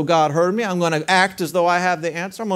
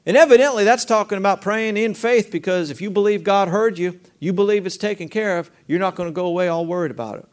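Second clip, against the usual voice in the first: ordinary talk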